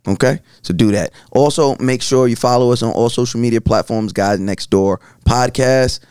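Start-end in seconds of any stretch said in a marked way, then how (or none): none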